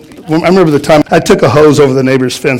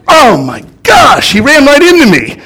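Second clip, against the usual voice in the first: startled outcry; 8 semitones higher than usual. Retold or imitated. Retold collision in Italy.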